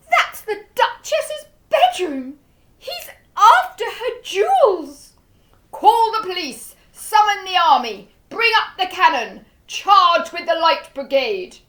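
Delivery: loud, high frantic orders; panicked shouting. A narrator's reading.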